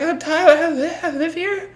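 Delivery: high, laughing panicked stutter; boy stammering his name at the cop; terrified stammer. Retold or imitated. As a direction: stammer it like a scared kid. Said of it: "I was like".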